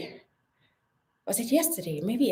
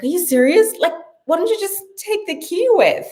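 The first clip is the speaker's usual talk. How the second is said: higher, louder exasperated retort; 12 semitones higher, 6 dB louder than usual. Retold or imitated. Telling her husband off for not taking the key, exasperated and high. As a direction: act out the annoyed complaint. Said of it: "I'm just like".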